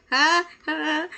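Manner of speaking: usual speaking voice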